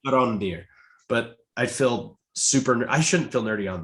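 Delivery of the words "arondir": Arondir said uh-RON-deer; corrected pronunciation. Shown as a demonstration. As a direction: settle on Arondir with an open RON in the middle. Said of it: "I think it's actually".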